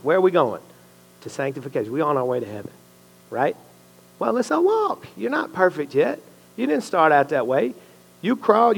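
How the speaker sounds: ordinary talk, nothing marked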